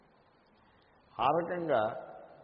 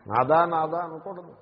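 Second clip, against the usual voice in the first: scolding; 3 semitones higher, 9 dB louder than usual. Real, not acted. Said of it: "scolding the wrong form".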